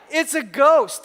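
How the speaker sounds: high, loud mock ghost scare; hammed-up frightened yell; mock terrified cry. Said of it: "they were terrified".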